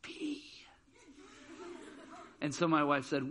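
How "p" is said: tiny high whisper into someone's ear; soft, high-pitched whispered confession; scared whisper. Retold or imitated. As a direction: imitate a scared child whispering a secret. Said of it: "whispered in my wife's ear".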